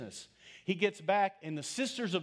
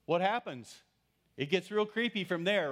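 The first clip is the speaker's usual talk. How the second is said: ordinary delivery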